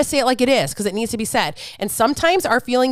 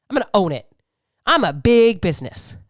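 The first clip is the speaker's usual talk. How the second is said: normal talking voice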